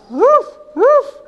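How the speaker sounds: high playful woof woof like a little dog; dog bark. Shown as a demonstration. Mother imitates the dog for her toddler, high playful bark. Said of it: "The dog barks".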